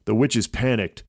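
ordinary delivery, nothing marked

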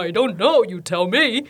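lightly voiced bored doctor; dismissive doctor voice. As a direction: toss it off like a careless doctor. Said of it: "超火大".

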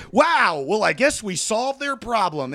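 a glib host closing the segment; mock Ryan Seacrest. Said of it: "Ryan's still on the phone like".

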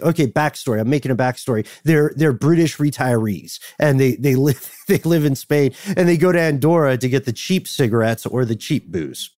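ordinary talk

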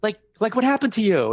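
high, urgent mock appeal; retold incredulous appeal. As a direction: plead in a high voice, acting out disbelief. Imploring his cousin in the story.

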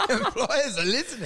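high, laughing repeat. Retold or imitated. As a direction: giggle the words out high. Laughing repeat of own quote.

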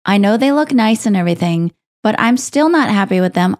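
ordinary delivery

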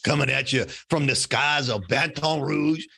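mock drive-time radio host intro; hammed-up, drawn-out radio voice; radio announcer. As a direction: stretch it out like a traffic-report DJ. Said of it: "he's got the coolest podcast radio voice".

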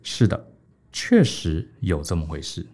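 slow, low and soft admission; calmly concede, no hurry at all; a low, slow, unruffled yes; unhurried calm. Acted. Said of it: "陈轸这时候就不慌不忙".

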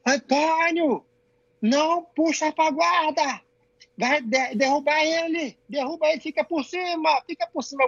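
high, drawn-out coaching call; slow, high-pitched mock sideline yell. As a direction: imitate a coach hollering instructions across the mat, without full volume. Retold coaching call to his son.

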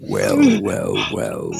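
demonic voice. Acted. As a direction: speak as Satan, menacing and rasping. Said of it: "a wonderful skill at doing a demonic voice".

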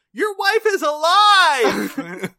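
high, drawn-out mock-angry yell; comic full-voiced high shriek; hammed-up outraged shout. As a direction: burst in shouting an outrageous revelation. Mirror Genie barging in, berating sitcom character.

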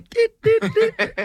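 squeaky little "dit dit dit"; very high, playful poking noise; high comic sound effect. Retold or imitated. Imitated noise of finger going in.

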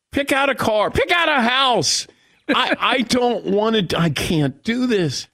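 high-pitched, overwrought bargaining; hammed-up desperate plea; mock desperate pleading. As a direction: beg in comic panic, slowing toward the end. Begging daughters to skip a ceremony.

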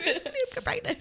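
high, fast, laughing joke; giggling through the words. Real, not acted. Joking scheme, laughing.

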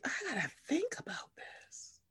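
whispered to herself; hushed inner thought. Retold or imitated. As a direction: whisper it as private thinking. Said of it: "I was just sitting here like".

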